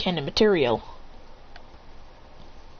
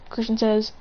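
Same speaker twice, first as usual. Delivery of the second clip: ordinary delivery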